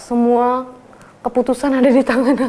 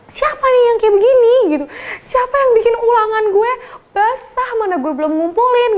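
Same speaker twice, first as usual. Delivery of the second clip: high, loud angry demand; retold anger; 10 semitones higher, 3 dB louder than usual. Student fuming at classmates over her soaked exam, high loud. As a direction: demand who did it, furious.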